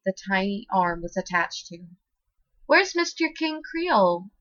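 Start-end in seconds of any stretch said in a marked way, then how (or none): 2.7-4.3 put-on little girl. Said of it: "Olivia asked with her big old happy eyes".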